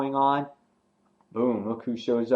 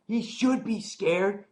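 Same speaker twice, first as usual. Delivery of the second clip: much higher, slow menacing line; menacing threat; 10 semitones higher, slower than usual. Retold or imitated. Nicholas threatening the boy, much higher and slow. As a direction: drag it out like a threat. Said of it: "he goes".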